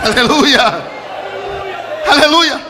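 loud, high cry of praise; shouted praise; ecstatic hallelujah. Real, not acted. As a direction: cry it out to heaven.

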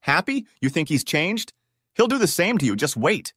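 high, scornful scoff. A narrator's reading. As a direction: sneer at the idea. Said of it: "Karen scoffed".

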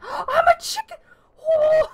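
very high, loud delighted squeal; shrieking amazement. Real, not acted. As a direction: squeal it out, breaking into laughter. Turned into a chicken.

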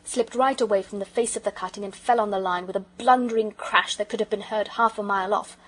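ordinary delivery, nothing marked

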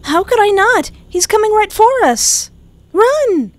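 high, loud panicked warning. Acted. Sonny warns the group the ghost is charging, panicked. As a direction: yell at them to flee in terror.

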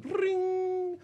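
very high sung "ring"; voiced phone ring. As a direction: imitate a telephone bell.

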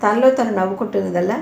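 ordinary delivery

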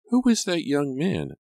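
young girl asks, lightly voiced; Angelica, girl voice. A narrator's reading. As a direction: voice the curious young heiress up high. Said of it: "Angelica asked finally".

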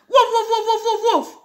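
rapid-fire high, loud woofs; frantic dog bark. Acted. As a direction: bark over and over like an agitated dog. Voicing the dog's barks.

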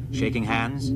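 usual speaking voice

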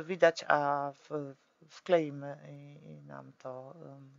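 ordinary delivery

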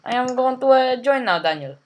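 thick put-on Russian accent, broken English; heavy Russian accent menace. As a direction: play a comic Russian gamer with a heavy accent. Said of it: "Shut up, talking like that, you sound stupid".

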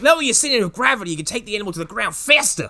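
high, fast instructive host voice; lightly voiced host coaching; wildlife host tips. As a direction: rattle off tips like a keen wildlife presenter. Irwin gives wrestling tips.

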